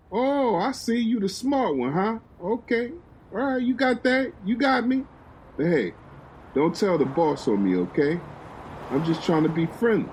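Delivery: high, teasing voice of a smooth talker; flirty guard. Acted. A guard teasing the woman he searched, high and playful.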